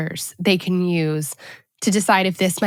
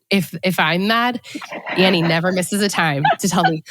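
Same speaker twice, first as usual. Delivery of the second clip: ordinary talk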